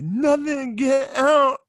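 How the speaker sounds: high-pitched bratty outburst; mock childhood screaming at parents; tantrum yell. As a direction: squeal it like a kid in a tantrum. Retold child yelling at parents.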